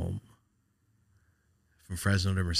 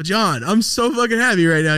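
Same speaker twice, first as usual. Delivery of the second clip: loud, high-pitched burst of joy; overjoyed; 12 semitones higher, 10 dB louder than usual. Retold or imitated. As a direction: re-enact an elated shout to a friend. Retelling his overjoyed reply to John.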